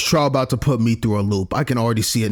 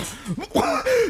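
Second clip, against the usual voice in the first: high burst of laughter; 4 dB quieter than usual. Real, not acted. After mock quote.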